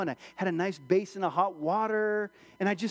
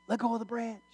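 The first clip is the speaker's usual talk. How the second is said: higher, restrained voice of God; voice from heaven; 5 semitones higher, 3 dB quieter, faster than usual. Acted. God answers the man on the cliff, even heavenly voice. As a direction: play God's voice, steady and held back. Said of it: "he hears this voice".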